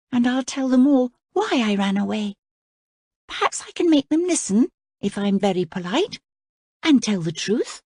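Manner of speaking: put-on a girl planning to talk her way out of trouble; princess Cora.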